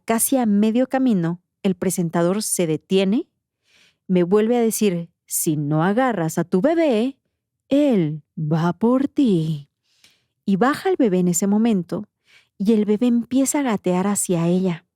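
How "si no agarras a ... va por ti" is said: slow, drawn-out threat at the end; ominous, heavily lengthened warning; menacing presenter. Retold or imitated. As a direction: imitate a sinister host, stretching the last words. Presenter threatens her in the nightmare.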